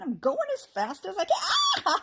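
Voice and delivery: high, loud, whiny goblin shout; grumbling goblin. A narrator's reading. Goblin complaining to his partner, whining. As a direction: whine it while struggling to keep up. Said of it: "grumbled the other one".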